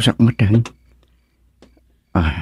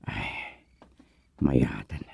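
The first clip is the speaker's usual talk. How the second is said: breathless whisper; sneaking whisper at the door; 8 semitones lower, 10 dB quieter than usual. Acted. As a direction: whisper so nobody hears.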